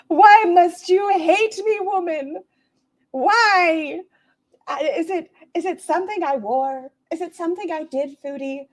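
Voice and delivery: high, far whiny plea; whiny pleading voice. Pretend. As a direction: whine at her like a wounded suitor. Mocks Foodie, pleading voice.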